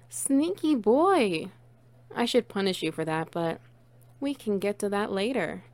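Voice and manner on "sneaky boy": high, slow playful tease; slow teasing. Acted. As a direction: drag it out teasingly. Teasing the pet.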